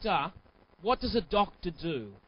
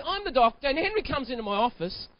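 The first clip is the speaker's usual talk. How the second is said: usual speaking voice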